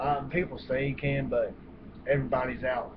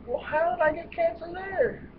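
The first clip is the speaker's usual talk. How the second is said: much higher put-on voice; mock patient question. Mocking a patient who questions the doctors, high put-on voice. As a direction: squeak it like a bewildered patient.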